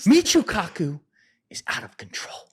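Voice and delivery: high, strained impression of an intense rant; mock ranting Weinstein. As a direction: ham up a self-serious genius demanding Michio Kaku. Imitating Eric Weinstein ranting on Rogan.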